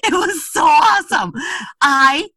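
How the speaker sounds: loud, high, gushing delight; excited. Real, not acted. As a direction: rave about it, voice way up. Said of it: "so awesome, gained ten pounds".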